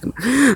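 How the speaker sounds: a sudden high gasp; sharp gasp. Acted. Reader startles mid-story with a gasp. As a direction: catch your breath in shock.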